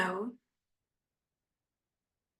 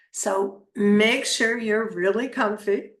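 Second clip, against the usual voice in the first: right in your ear; slower than usual. Acted. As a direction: ease them into relaxation. Leads a guided meditation.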